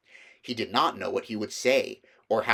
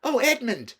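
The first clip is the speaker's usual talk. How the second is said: high, loud joyful cry; delighted to find her brother; Lucy crying out happily; excited cry; 5 dB louder than usual. A narrator's reading. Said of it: "she cried".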